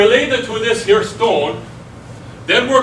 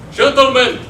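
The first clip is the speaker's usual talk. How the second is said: raised, ringing call to begin; big showman's challenge; rallying announcer; 4 semitones higher, 4 dB louder than usual. Acted. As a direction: summon the debaters like a ring announcer. Host calls debaters to begin.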